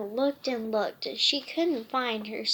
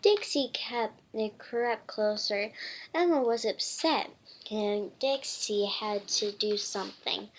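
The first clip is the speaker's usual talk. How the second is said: ordinary delivery, nothing marked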